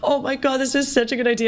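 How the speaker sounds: a lightly voiced husband, delighted; husband thrilled by the idea, mildly hammed; excited husband quote. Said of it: "He goes".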